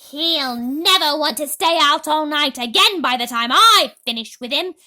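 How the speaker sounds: loud, menacing then startled; threaten, then jump at the noise; threatening Aunt Spiker. A narrator's reading. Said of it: "Aunt Spiker said".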